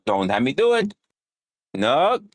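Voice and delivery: ordinary delivery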